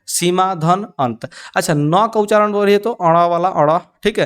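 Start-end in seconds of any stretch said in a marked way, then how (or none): 0.1-1.3 सीमा धन अंत, each part slow and separate; slow classroom dictation of सीमा धन अंत; slow spelled-out dictation. Shown as a demonstration. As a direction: read it slowly with धन, not plus. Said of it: "इसको धन पढ़िएगा".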